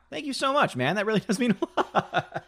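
high, laughing thanks; flattered laughing. Real, not acted. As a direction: laugh through the thank-you.

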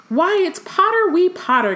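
high-pitched teasing poltergeist; cackling Peeves. A narrator's reading. Poltergeist teasing a passing schoolboy, cackling. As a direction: pitch it way up, gleefully needling. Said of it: "cackled Peeves".

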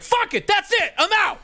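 explosive mock outburst. Comic imitating a partner snapping over a dropped fork, high mock shout. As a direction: blow up and storm out in mock fury.

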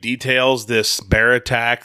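usual speaking voice

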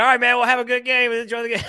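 high, loud, hurried mock farewell; retold awkward sign-off. Fan backing out of chat with upset stranger, hammed-up hasty farewell. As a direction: rush out a too-cheery goodbye.

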